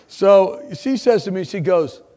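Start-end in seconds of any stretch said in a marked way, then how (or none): none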